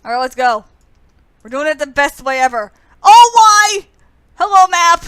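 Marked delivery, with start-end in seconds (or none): 3.0-3.9 loud, high wail of despair; anguished yell. Real, not acted. Dying in game. 4.4-5.0 loud greeting. Real, not acted. Greeting the map.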